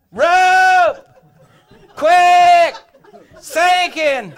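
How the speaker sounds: very high, very loud holler, word by word; full-force shout for rescue; retold distress yell. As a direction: bellow it out as loud as you can. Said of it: "all of a sudden I yelled".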